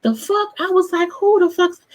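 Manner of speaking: high, stunned outburst; outraged disbelief. Retold or imitated. Host reacting to a contestant's claim, high and stunned. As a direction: burst out in shock.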